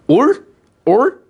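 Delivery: "올?" echoed high and loud, no roll in the R; unrolled R. Retold or imitated. Teacher echoing a student's answer, unrolled "올?" in loud disbelief. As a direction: mimic a student's plain L-like "올?", questioning. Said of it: "에이, 좀 굴려야지".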